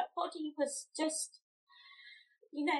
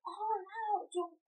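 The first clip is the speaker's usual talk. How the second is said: high-pitched imitation of impressed listeners; retold delighted reaction; 7 semitones higher than usual. Mother voicing nurses greeting her with the milk, delighted. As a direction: echo their delight in a raised voice.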